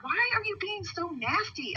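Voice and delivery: high, slow, drawn-out pleading question; retold protest. Woman retelling her plea to the driver, retold protest. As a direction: re-enact an upset challenge, pitched well up.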